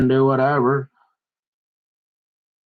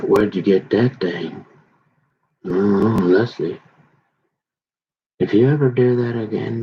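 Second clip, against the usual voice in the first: retold angry threat; 5 semitones lower than usual. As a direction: growl the threat low at the roommate. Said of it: "I'm getting really pissed now".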